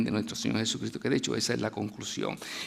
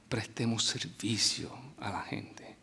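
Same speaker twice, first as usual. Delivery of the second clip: whispered emphasis; 4 semitones lower, 9 dB quieter, slower than usual. Real, not acted. Preacher drops to whisper.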